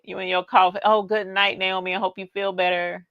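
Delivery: ordinary delivery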